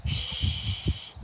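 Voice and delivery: whispered shh; baby's shush. Acted. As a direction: hush like a baby. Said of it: "said the baby".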